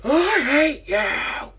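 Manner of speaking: drawn-out high, loud scream of hatred; hammed-up furious outburst; Anakin's hateful scream. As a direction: scream it slowly at the top of your range. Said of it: "where he's like".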